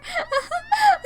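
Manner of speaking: high hysterical cackle; manic shrieking laugh. Acted. Crazed girl laughs over the body, hysterical cackle. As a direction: cackle unhinged.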